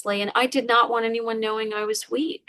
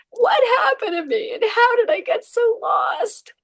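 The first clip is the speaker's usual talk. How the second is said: much higher, anguished plea to yourself; despairing question; 14 semitones higher, 4 dB louder than usual. Retold or imitated. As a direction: cry out lost and bewildered.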